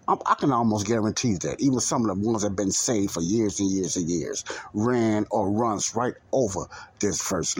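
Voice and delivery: ordinary talk, nothing marked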